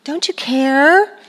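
put-on slow, drawn-out whiny teen; mock teenage whine; whining teenager. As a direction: whine like a kid who thinks mom doesn't care. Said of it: "they're whining and they're annoying".

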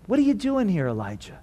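gently ask, letting the name fall away; quiet, caring appeal; tender. Acted. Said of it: "God is asking this very tenderly".

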